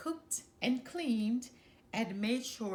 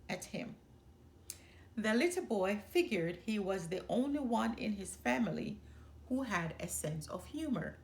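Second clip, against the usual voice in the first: plain reading voice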